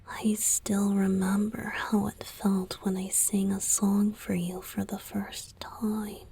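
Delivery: ordinary delivery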